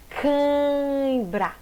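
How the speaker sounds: "Cãibra" drawn out slowly, strong nasal A; "Cãibra" said very slowly with a heavy A; slow stretched cãibra. Shown as a demonstration. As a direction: stretch "Cãibra" to show the strong A before the glide. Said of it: "Tá vendo que o A tem mais força?".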